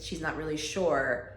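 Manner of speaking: ordinary delivery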